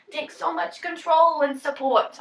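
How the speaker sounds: ordinary talk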